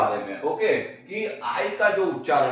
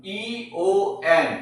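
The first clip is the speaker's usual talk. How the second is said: letters spelled out slowly; 3 semitones higher, much slower than usual. Shown as a demonstration. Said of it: "क्या है?".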